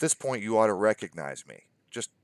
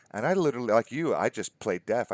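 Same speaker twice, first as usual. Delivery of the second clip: ordinary delivery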